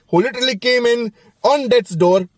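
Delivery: ordinary talk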